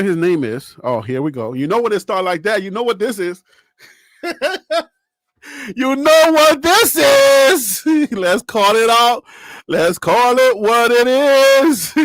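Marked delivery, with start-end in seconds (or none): 5.8-11.9 loud, slow, drawn-out hype; hyped call-out. Real, not acted. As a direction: stretch every word, loud and gleeful. Hyped start of segment.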